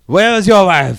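loud, high mock French accent; French pirate. Pirate demanding a man's wife, loud French accent. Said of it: "weird French pirate crew".

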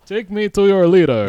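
high, loud conqueror's demand; booming arrogant alien overlord. Acted. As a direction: proclaim your rule.

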